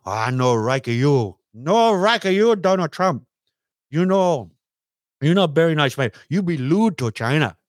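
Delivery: lightly voiced mock Chinese accent. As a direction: needle Trump in a put-on Chinese accent. Second caller voice answering Trump.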